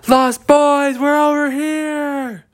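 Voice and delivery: high drawn out yell for help; yelling back. Acted. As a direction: shout to be found. Trapped characters yell back.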